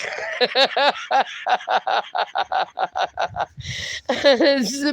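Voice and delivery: rolling laugh that fades and returns; real long laugh. Real, not acted. Host laughing at a chat message, long amused laugh. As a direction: burst out and keep giggling.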